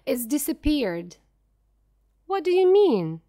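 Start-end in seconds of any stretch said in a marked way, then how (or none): 2.3-3.2 higher, louder sudden question; startled, demand to know; alarmed. A narrator's reading. Shocked reaction to news.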